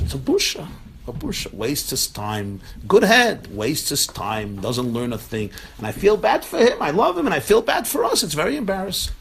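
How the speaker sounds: high, loud lament over a son; exasperated father. Retold or imitated. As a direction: exclaim it in pained embarrassment. Acted father lamenting his embarrassing son.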